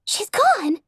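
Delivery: a stranger crying out in alarm; panicked crowd pony. Acted. Pony in the crowd panicking that the singer is gone.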